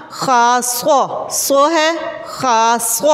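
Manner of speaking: खास्व very slow, with स्व said clearly and not सा; stressed स्व. Shown as a demonstration. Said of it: "सा नहीं पढ़ना". (खास्व धीरे और ज़ोर से, स्व पर ज़ोर)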